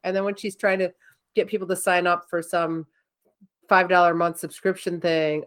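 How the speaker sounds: ordinary talk, nothing marked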